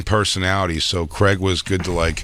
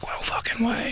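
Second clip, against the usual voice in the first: breathless whispered disbelief; stunned whisper; 8 dB quieter than usual. Retold or imitated. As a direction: whisper it like you can't believe your eyes. Seeing Howard Stern leave club.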